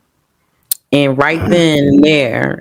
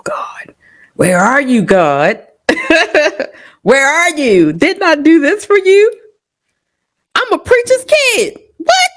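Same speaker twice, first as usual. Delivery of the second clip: desperate plea to God. Retold or imitated. Preacher's kid mother pleading with God over her child. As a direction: cry out to God in disbelief. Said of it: "you know".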